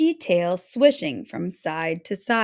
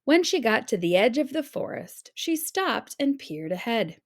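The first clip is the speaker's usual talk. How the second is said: ordinary delivery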